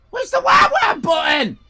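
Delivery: high, loud, exasperated yell; frantic exasperated shout. Real, not acted. Streamer hunting for the wah wah button, exasperated shout.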